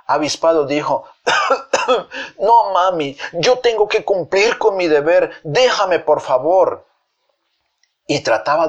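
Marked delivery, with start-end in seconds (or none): 1.3-2.3 short, high put-on cough; mock sickbed coughing; feigned cough. Avispado fakes illness. 2.4-6.9 high, strained mock-invalid plea; hammed-up suffering child begging; feigned sick pleading. Fake invalid pleads to work.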